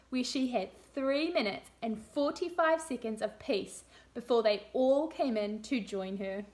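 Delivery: put-on lively read-aloud for young children; storybook reading. A narrator's reading. Teacher reading a story to room two, lively storybook voice. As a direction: read it like a picture book to a class.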